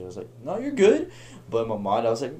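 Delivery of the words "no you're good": high-pitched, louder breezy reply; imitated high "all good" voice; put-on reassurance. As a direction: act out an overly easy forgiveness, pitched way up. Putting on a brave reply.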